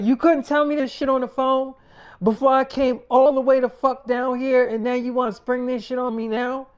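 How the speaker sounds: acted outburst, stressing the swear words; angry confrontation. Jilted boyfriend confronting the woman who left him, angry.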